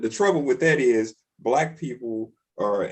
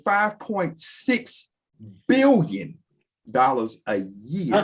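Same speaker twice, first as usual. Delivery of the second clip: five point six spelled out slowly, each word weighted; slow emphatic repeat; slower than usual. Real, not acted. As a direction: lean on every word so it lands. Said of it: "did you hear what I said?".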